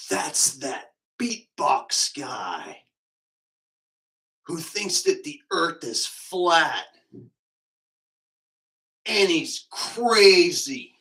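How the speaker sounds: very slow, drawn-out amazed quote; awed onlooker. Retold or imitated. People spotting the beatbox guy, slow mock awe. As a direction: stretch each phrase with long pauses, in mock awe. Voicing people who recognise him.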